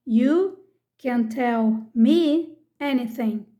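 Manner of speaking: slow, deliberate second reading; slow repeat. Shown as a demonstration. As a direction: repeat slowly, word by word for learners. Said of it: "Vamos ver alguns exemplos aqui".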